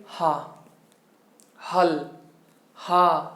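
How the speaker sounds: "हा," with air straight from the throat; throat-made ha. Shown as a demonstration. Teacher showing where ha is made, throat-made "हा,". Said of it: "कंठ से सीधे आपकी हवा निकल रही है".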